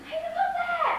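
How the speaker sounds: high-pitched urgent call; sudden high cry of alert. Acted. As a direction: shout to point something out. Calling out to point something out.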